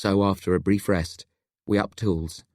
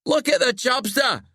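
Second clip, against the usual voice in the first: high, mocking jeer; gleeful howl. A narrator's reading. Locke mocks his crying friend, howling with delight. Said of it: "Locke howls with delight".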